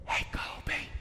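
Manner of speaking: hushed unvoiced whisper across the table. Friend whispering to Colby at lunch, hushed whisper. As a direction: whisper so the teacher can't hear. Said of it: "he leans over and he said".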